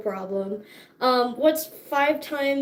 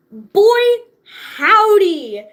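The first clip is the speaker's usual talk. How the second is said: loud exclamation; 6 semitones higher, 9 dB louder, much slower than usual. Real, not acted. Vlogger to her viewers about leg day, a loud high whoop. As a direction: belt it out like a cowboy whoop.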